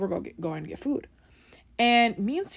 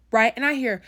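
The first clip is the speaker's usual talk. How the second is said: ordinary talk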